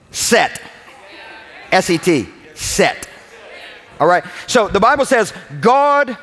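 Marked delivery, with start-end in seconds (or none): 0.1-3.1 crisp careful "set"; spelled out. Shown as a demonstration. Pastor spelling the word for the congregation, crisp and careful. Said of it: "when I say".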